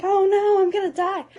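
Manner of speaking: high, loud mock panic. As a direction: squeal in hammed-up terror. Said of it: "and I'm like".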